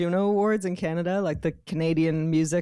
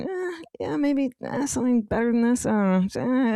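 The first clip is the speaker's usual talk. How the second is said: high, mock-bored, dismissive mumble; unimpressed crew; 6 semitones higher than usual. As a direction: shrug it off like an unimpressed onlooker. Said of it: "looking at me going".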